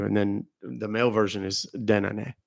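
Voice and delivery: ordinary talk, nothing marked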